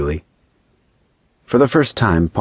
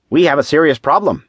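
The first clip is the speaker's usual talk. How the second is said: high-pitched, urgent council voice; concerned angel; 10 semitones higher, 4 dB louder than usual. A narrator's reading. Angel opening the council's talk on Paul, urgent. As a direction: announce trouble in a raised pitch.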